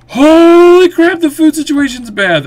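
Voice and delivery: high, slow, drawn-out cry of alarm; shocked alarm. Acted. To viewers, spotting the food crisis: high, slow shocked wail. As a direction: wail it out as if disaster struck.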